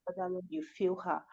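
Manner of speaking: soft, earnest spoken prayer; hushed, even prayer of thanks; closing prayer. Real, not acted.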